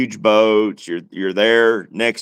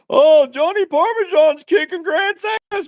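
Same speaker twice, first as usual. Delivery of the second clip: very high-pitched imitation of screaming fans; mock screaming. Mimicking viewers who cheer on a debater, mock screaming. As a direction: squeal it out like an overexcited viewer, mocking them. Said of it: "you'd be screaming".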